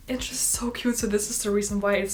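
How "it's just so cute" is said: whispered giggle. Real, not acted. Giggly aside about cute scene.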